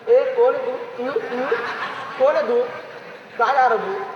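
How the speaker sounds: a hint of a gruff watchman, chuckling; lightly voiced watchman challenging a stranger; imitating the watchman. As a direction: watchman stopping the student at the gate, half-laughing. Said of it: "मग आमचं watchman".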